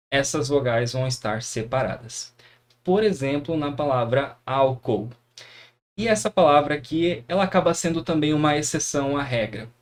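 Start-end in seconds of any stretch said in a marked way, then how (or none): none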